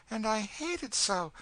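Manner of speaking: put-on high, upset girl's voice; distressed young girl. Girl confiding in companion about the brandy, distressed.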